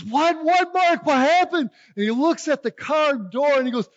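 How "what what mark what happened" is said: high, loud, startled what-happened; the father rushing out in alarm; alarmed dad. Retold or imitated. Dad asking son what happened to the car, alarmed.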